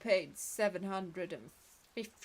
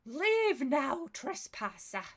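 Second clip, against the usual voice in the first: ancient rasping undead king; 3 semitones higher, 4 dB louder, slower than usual. Acted. Spirit orders intruder out.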